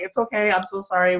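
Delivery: a hint of comic over-politeness; mock apologetic Canadian. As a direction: apologize too much, for laughs. Acting out over-apologetic Canadian reply.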